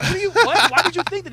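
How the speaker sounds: a high, loud retold what; baffled disbelief. Fans at the rail shocked he thought they hated him, loud disbelief. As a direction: squeal it like you can't believe it.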